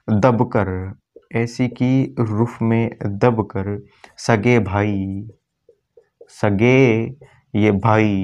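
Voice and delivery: very slow, word by word, long pauses; slow mnemonic recital. Shown as a demonstration. Teacher dictating the mnemonic word by word to students. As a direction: dictate the trick one word at a time. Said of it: "trick अपनी क्या निकलेगी".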